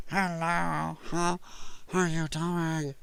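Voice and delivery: soft, very drawn-out odd greeting; mocking her stuffed-nose voice. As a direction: imitate her with every word stretched and softened. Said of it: "your car fender stuck up your nose".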